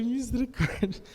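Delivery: a drawn-out "mercy" in mock fear; mock dread. Hearing Suzana has a message for him, drawn-out mock dread.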